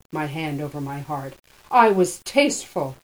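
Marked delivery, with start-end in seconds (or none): 1.7-3.0 cried out. A narrator's reading. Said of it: "I cried".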